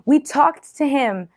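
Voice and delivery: high, loud, excited announcement; rejoicing. A narrator's reading. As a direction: burst out with joyful news, slowing on the last words. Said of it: "they're rejoicing".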